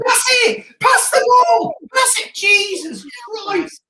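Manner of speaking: very high, frantic pleading yells; overexcited shouting. Real, not acted. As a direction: shout desperately at the player, ending drawn out in exasperation. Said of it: "I got overexcited then".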